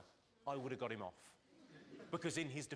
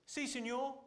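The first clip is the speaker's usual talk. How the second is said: mock shouted reply; 8 semitones higher, 5 dB louder, slower than usual. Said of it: "Someone shouted".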